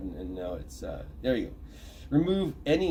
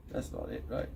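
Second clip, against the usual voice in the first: muttered aside; 11 semitones lower, 8 dB quieter than usual. Real, not acted. Muttering to self while searching.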